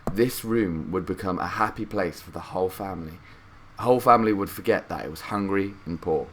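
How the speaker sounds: ordinary delivery, nothing marked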